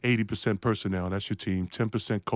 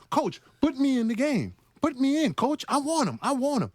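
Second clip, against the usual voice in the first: high-pitched, eager begging to be put in; eager pleading; 11 semitones higher than usual. Retold or imitated. Rookie on the bench begging his coach to play, eager pleading. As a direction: beg the coach like an over-keen rookie.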